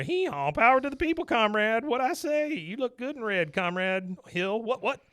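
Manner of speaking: high braying puppet voice with hee-haw; donkey puppet voice. Retold or imitated. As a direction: bray it high and silly. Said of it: "you hear".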